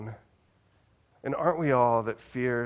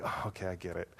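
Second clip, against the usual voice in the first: resigned realization; 6 semitones lower, 12 dB quieter, much faster than usual. Retold or imitated. Pastor voices Peter's weary reply.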